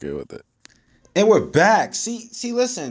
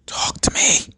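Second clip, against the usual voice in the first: whisper; 13 semitones higher, 4 dB louder than usual. Retold or imitated. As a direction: whisper it to coax him.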